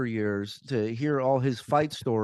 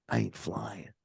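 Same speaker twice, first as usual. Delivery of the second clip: low mutter; 3 semitones lower, 9 dB quieter than usual. Retold or imitated. Thug's curt reply, retold.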